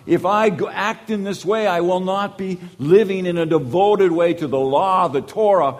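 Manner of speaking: a devout man's worried objection; upset Joseph. Retold or imitated. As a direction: Joseph pleading his devotion to the law. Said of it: "This is what Joseph is upset about".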